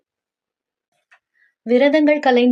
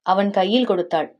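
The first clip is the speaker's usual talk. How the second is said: ordinary delivery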